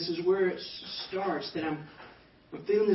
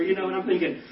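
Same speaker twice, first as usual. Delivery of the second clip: usual speaking voice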